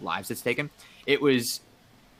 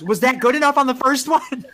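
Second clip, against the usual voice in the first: high, loud, worried voice of a flustered friend; mock-panicked friend, much higher and louder; anxious friend; 13 semitones higher, 7 dB louder than usual. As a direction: squeak it out like she's terrified she did it wrong. Shannon anxiously asking after swab.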